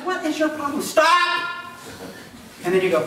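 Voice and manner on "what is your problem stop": annoyed Jesus. Acted. Jesus woken in storm.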